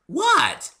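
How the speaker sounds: high, loud innocent what. A narrator's reading. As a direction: play dumb sweetly. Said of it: "she asked innocently".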